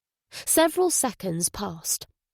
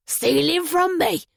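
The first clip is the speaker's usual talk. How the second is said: higher, slow, drawn-out disbelief; 7 semitones higher, 4 dB louder than usual. A narrator's reading. Mark breaking in on the detective, in disbelief. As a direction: stretch the words in disbelief. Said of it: "Mark broke in with disbelief".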